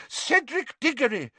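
high, loud and fierce; furious McGonagall. A narrator's reading. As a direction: hurl the names at him.

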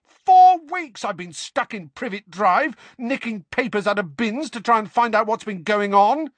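high, very loud rant; furious shouting. A narrator's reading. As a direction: yell out weeks of pent-up grievance. Harry rages at his friends.

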